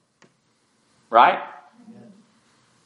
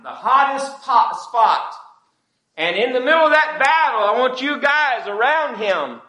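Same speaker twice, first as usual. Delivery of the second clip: impassioned preaching; 9 semitones higher than usual. Acted. Preacher reading the king's orders to Joab, impassioned and high-pitched. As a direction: build from raised, higher pitch to high, ringing preaching.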